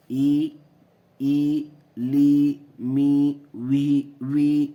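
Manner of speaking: ای لی می وی each held long and loud; drawn-out vowel sounds. Shown as a demonstration. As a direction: sound each syllable slowly. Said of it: "اس کی آواز آئے گی".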